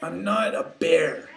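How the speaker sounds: slow, loud, emphatic denial; indignant denial. Acted. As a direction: stress each word in annoyance. Kenai angrily denies being bear.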